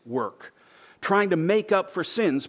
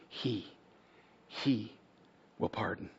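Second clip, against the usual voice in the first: hushed emphasis; 4 semitones lower, 9 dB quieter than usual. Acted. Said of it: "quiet emphatic repeat".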